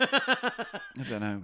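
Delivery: laughing through the words. Real, not acted. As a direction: say it while laughing. Laughing at a joke band name.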